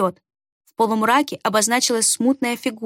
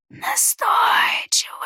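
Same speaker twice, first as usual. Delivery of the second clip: high raspy whisper, slow; stranger's whisper; 12 semitones higher, much slower than usual. A narrator's reading. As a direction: rasp it out slowly, eerie. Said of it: "хмыкнул незнакомец".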